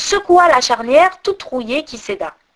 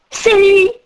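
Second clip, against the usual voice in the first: high, louder joyful exclamation; excited cry; 9 semitones higher, 5 dB louder than usual. A narrator's reading. Gerda recognizing Kay in the crow's tale, high excited cry. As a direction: shout it out in delight. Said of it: "s'écria Gerda".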